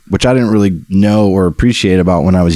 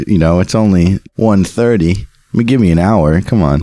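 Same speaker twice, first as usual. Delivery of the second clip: ordinary talk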